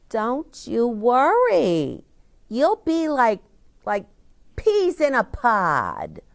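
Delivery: a bright teacher calming a worried pupil; cheerful teacher. A narrator's reading. Teacher reassures unhappy child.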